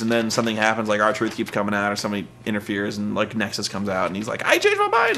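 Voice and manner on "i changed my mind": mock cowardly retreat. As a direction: squeak it in imitated panic. Miz backing out, acted.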